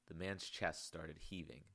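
ordinary delivery, nothing marked

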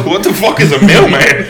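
loud, high laughing question; incredulous question through laughter; laughing dog voice. Acted. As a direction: play a baffled dog while cracking up. Laughing while voicing the talking dog.

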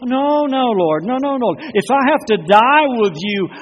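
loud, high, desperate no no no; vehement protest. Acted. Peter insisting to Jesus, loud and vehement. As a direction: protest with all you've got.

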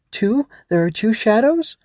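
high-pitched startled questions; alarmed child. Acted. Ava learns there are two shadows, alarmed.

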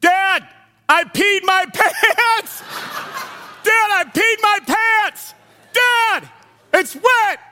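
put-on loud, high, slow child's yell. Son announcing to dad and plane, loud and drawn out. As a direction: wail it out so the whole plane hears. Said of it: "my son yells and goes".

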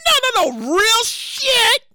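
normal talking voice

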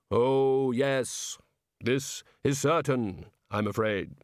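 slow, ponderous Reaper; Death. Acted. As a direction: intone it gravely, word by word. Death confirms the doom.

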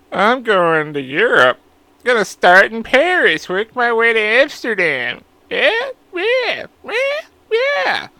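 high, slow mocking impression of a friend; mocking Nick. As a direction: ham up the high sing-song voice, drawing out Paris and Amsterdam. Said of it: "That's how he sounds".

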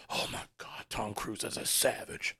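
hushed, breathy whisper of mock awe; awed whisper. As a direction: whisper it like a starstruck young viewer, drawing it out. Awed teenage viewer reacting to movie.

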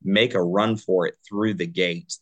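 ordinary talk, nothing marked

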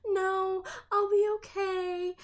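put-on high, pleading drunk friend voice. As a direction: play the drunk friend insisting she's fine. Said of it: "she was like".

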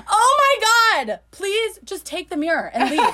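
playfully fed-up outburst; exasperated plea. Retold or imitated. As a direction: beg in comic exasperation. Acting out exasperation at Bridget.

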